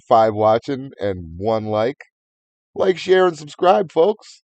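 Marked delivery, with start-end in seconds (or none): 2.8-4.4 higher, announcer-style plug; hammed-up channel plug; youtuber voice. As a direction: sell it like a cheesy streamer. Youtuber sign-off parody.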